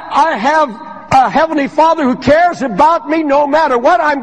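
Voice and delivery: rising sermon cadence toward the promise of heaven; fervent preaching. Real, not acted. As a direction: build it up like a revival preacher.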